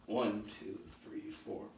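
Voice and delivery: hushed count with the steps; counting softly. Shown as a demonstration. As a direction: breathe out a soft count.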